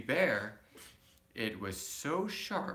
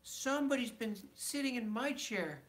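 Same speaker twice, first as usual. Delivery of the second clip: lightly raised middle-sized bear voice; a touch higher, medium bear; 9 semitones higher than usual. Acted. Reading a bedtime story aloud, middle bear voice. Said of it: "in his middle voice".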